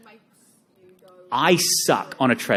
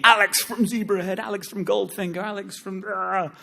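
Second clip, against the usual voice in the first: raised, sing-song self-announcement; puffed-up band identity; 9 semitones higher than usual. Acted. Telling the audience who he used to be, high and showy.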